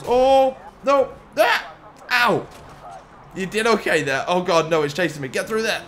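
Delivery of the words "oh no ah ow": high, loud startled cries; panicked yelp. Real, not acted. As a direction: yelp as the car hits. Crash in police chase.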